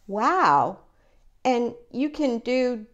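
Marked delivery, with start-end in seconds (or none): none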